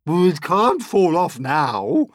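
slow, loud, high proclamation; shout. A narrator's reading. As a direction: shout it out, stretching the words. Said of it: "Aunt Sponge shouted".

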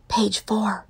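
soft unvoiced whisper; hushed classroom whisper. A narrator's reading. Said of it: "Todd whispered".

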